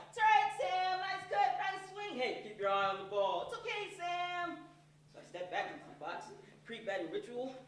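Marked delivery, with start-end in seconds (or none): 0.1-4.6 high, loud encouraging cheers; crowd cheering. Retold or imitated. Crowd encouraging the kid batter, high and loud. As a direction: cheer him on from the stands. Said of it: "you hear the crowd".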